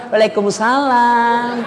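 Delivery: "Waalaikumsalam" stretched out slowly and cooingly; drawn-out sweet answer. Shown as a demonstration. Girl answering boyfriend's call, slowly and sweetly. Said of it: "jangan kau juga mendayu-dayu".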